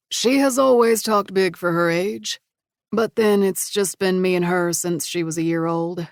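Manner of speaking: a hint of a proud dad; friendly father chatting; lightly voiced father. Brock's voice.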